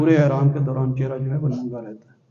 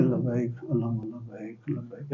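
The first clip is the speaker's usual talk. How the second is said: soft, low, limp labbaik; لبیک اللہم لبیک mumbled softly as the wrong way; timid mumble; 3 semitones lower, 10 dB quieter, slower than usual. Shown as a demonstration. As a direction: say لبیک اللہم لبیک softly and limply, without fervour. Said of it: "اس طرح نہیں پڑھنا".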